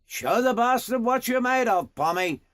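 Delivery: high-pitched goading yell; Aussie boss hollering encouragement. A narrator's reading. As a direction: call out a challenge to the rider. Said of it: "Defoe yelled out again".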